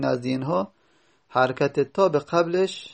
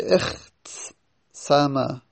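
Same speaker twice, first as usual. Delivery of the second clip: slow demo; much slower than usual. Shown as a demonstration. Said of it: "teacher spells out form".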